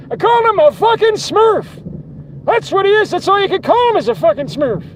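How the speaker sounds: high, loud rant; ranting yell. Real, not acted.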